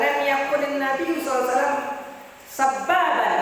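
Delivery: chanted Arabic quote; Arabic hadith recitation. Retold or imitated. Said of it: "Anas bin Malik radhiyallahu anhu berkata".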